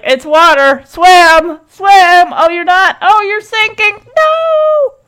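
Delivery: urging, climbing to a high wail; mock panic building to a drawn-out high no; mock duckling rescue. Cheering on a duckling that sinks.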